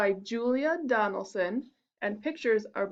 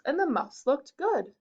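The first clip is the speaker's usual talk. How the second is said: plain reading voice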